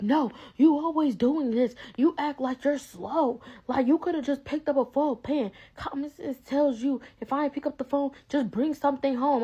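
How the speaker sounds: high-pitched imitation of a scolding mom; mom with attitude. Mom blaming daughter over foil pans, mock scolding. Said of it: "she got an attitude with me".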